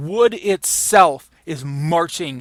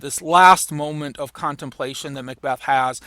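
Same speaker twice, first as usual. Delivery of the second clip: ordinary talk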